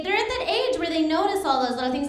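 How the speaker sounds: ordinary talk, nothing marked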